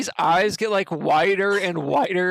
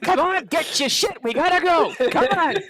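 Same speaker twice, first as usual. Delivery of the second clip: Gilbert Gottfried scream; 10 semitones higher than usual. Acted. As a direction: shriek everyone awake in a panic. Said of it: "hears Gilbert Godfrey screaming at the top of his lungs".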